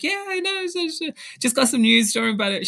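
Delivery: mock-calm high voice hiding big news; giddy squeak. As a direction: squeak it out like you can't keep a secret. Reassuring mom, bursting with news.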